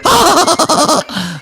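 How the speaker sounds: very high, loud mimicked cackle; Roy Keane laugh. Footballer echoes his imitator, high loud cackle. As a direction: answer with a shrieking put-on laugh. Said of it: "and he went".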